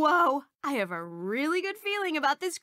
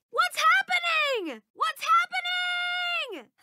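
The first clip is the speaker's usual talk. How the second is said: high, slow, panicked call; startled, stretched-out shout; alarmed cry; 14 semitones higher, slower than usual. Acted. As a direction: cry out as the world shifts. Something strange overtakes her.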